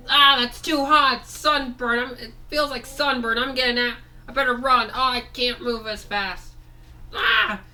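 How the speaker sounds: high, loud voice of a toy getting sunburnt; burning toy figure. Acted. Toy figure complaining about the hot sunburn, high pained voice. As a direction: yelp it out like a little creature that's too hot.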